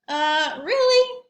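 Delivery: high, loud sceptical "really?"; doubtful surprise. Acted. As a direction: doubt every word he says. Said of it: "Balloon said".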